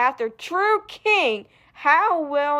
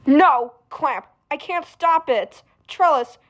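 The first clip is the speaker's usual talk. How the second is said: ordinary delivery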